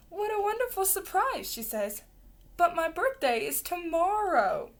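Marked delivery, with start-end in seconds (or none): 0.1-1.5 high, lilting storybook mama; pitched well up, delighted; delighted surprise. A narrator's reading. 2.6-4.7 high, louder storybook exclamation; mama's raised, puzzled protest; surprised mama. A narrator's reading. Mama corrects the monkeys.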